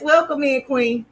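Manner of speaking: ordinary delivery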